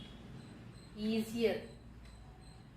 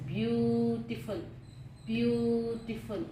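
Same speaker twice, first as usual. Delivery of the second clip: slow, careful syllables; slow model word; slower than usual. Shown as a demonstration. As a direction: draw out each syllable of beautiful. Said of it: "teacher models the word slowly".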